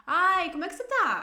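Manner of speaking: high, loud, gushing question; eager greeting. Acted. Someone comes up and asks you, eager and high. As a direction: greet with exaggerated interest. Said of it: "se alguém chega pra você e te pergunta".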